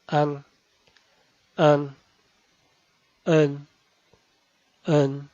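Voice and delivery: an, en, yin, wen, yun with a short light nasal; Mandarin front nasals. Shown as a demonstration. Teacher demonstrates front nasals, short and light. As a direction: say each syllable twice, nasal short and light. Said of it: "Esta se pronuncia".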